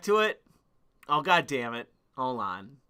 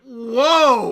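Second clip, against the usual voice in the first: surprised whoa; 6 semitones higher, 7 dB louder than usual. Retold or imitated. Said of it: "I was actually surprised".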